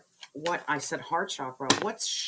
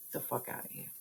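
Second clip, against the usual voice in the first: whispered dismissal; 2 semitones lower, 10 dB quieter, faster than usual. Acted. Dismissive aside to haters.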